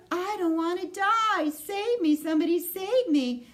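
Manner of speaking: high, loud pleading cries; panicked screaming. A narrator's reading. Terrified pig begging the barn to save him. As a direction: beg for your life at full volume. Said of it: "screamed Wilbur".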